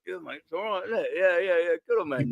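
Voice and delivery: soft, high put-on Manchester accent; a Londoner in a City-blue hoodie fakes Manchester to a home fan. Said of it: "didn't just use my London accent".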